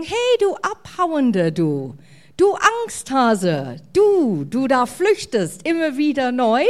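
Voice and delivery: drawn-out, emphatic name-calling; mock someone with stretched-out insults; mock scolding. Angel imagined berating a fleeing coward, mock scolding.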